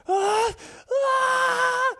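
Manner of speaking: put-on high crying ah, ah like a hurt child; imitated crying kid. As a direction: wail like a little kid in pain. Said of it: "he's like".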